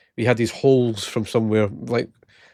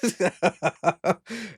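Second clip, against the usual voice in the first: laugh; 7 semitones higher, 6 dB quieter than usual. Real, not acted. Laughing at the prank.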